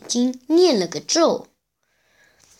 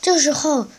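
usual speaking voice

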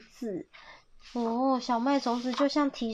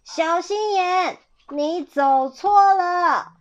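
high, slow, drawn-out call; loud singsong shout to mislead; 7 semitones higher, 6 dB louder, slower than usual. A narrator's reading. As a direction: holler down a tunnel. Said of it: "道外面叫".

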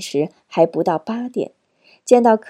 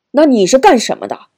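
loud, higher-pitched angry demand; angry challenge; 4 semitones higher, 7 dB louder than usual. A narrator's reading. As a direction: fire the question back in a temper. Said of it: "佩南火了". (火冒三丈地大声质问)

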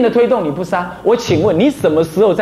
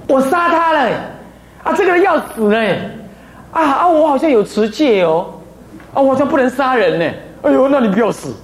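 higher, hammed-up belated panic; a fool who remembers his vows too late; mock killer dithering; 6 semitones higher than usual. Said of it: "你不会说". (夸张地扮演后知后觉的杀人者)

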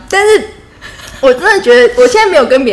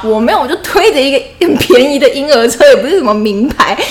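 loud, higher-pitched self-defence; indignant protest; 6 semitones higher, 7 dB louder than usual. Real, not acted. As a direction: protest loudly against the teasing. Protests teasing about being rich.

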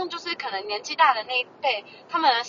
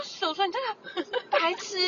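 slow mock insult; 6 semitones higher, 6 dB quieter, slower than usual. Insult to uncle.

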